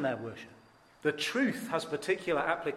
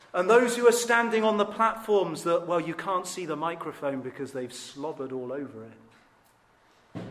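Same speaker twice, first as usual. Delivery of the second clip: ordinary talk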